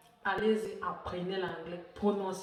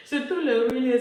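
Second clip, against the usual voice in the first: playful; 5 semitones higher, 12 dB louder than usual. Real, not acted. Playing with the word.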